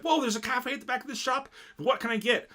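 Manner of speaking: high, amazed customer voice; surprised. Retold or imitated. Friends recapping a show, voicing amazed customers. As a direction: gasp it out like you just found a secret cafe. Excited customers discover back cafe.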